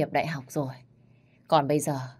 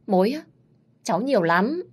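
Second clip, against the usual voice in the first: higher, louder startled boast; surprised boast; 6 semitones higher, 6 dB louder than usual. Acted. Granddaughter asked about boyfriends, surprised and boastful. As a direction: jump in with mock pride.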